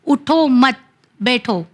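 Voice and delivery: "اٹھو مت", a clear stop, then "بیٹھو"; group "مت" with "اٹھو", then pause; pause after مت. Shown as a demonstration. Teacher giving an example of pausing, break after مت. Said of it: "جیسے ایک مثال کے طور پہ".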